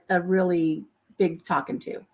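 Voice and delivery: ordinary talk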